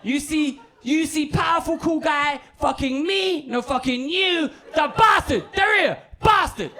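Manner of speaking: high, slow indignant rant; mock furious. Catfished man warning the comedian, high mock fury. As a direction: rant high, punching up the insults. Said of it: "he's furious".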